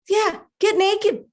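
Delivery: high, slow singsong coaxing; insistent inner voice. Acted. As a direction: stretch it out high, egging someone on. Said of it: "I hear this voice and it says".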